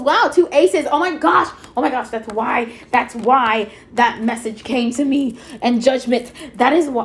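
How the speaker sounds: real thrill bursting out at two aces; freaking out. Real, not acted. Tarot reader to her viewers, thrilled by two aces, excited. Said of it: "Okay, I'm freaking out".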